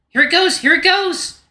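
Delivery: high, loud excited chant. Acted. Luke to the dogs before the turret fires, excited. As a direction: bounce with eager anticipation.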